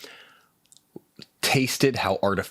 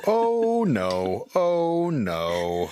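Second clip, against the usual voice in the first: dismayed; 7 semitones higher, much slower than usual. Real, not acted. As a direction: wail in dismay. Reacting to cherry pie taste.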